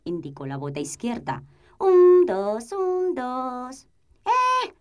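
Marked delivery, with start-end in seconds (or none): 1.8-3.9 high, loud marching chant; marching count. Acted. 4.2-4.7 high, loud indignant yell; cartoon princess. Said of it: "gritó la princesa".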